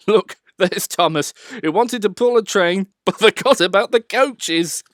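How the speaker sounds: loud, high teasing jeer; mocking. A narrator's reading. Other engines teasing Thomas, loud mocking jeer. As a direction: taunt him like laughing schoolmates. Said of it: "the other engines laughed at Thomas and said".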